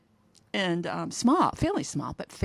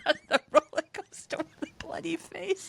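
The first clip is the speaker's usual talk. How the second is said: high-pitched words through real laughter; laughing; 9 semitones higher than usual. Real, not acted. Guest recalling Fabio's roller coaster mishap, laughing. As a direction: struggle to speak while cracking up. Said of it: "Sorry for laughing".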